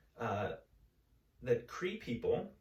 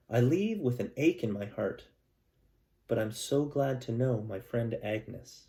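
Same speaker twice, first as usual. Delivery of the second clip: louder, gentle read-aloud storytelling voice; story narration; 2 semitones higher, 7 dB louder than usual. Acted. Teacher reading a picture book to her class, storytelling voice.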